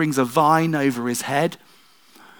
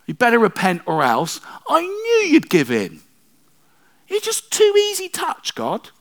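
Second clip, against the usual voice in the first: much higher, louder whiny indignant protest to God; Jonah's complaint; 12 semitones higher, 4 dB louder than usual. Acted. Jonah complaining to God, high petulant indignant whine. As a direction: whine at God like a sulking prophet. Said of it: "he says these words".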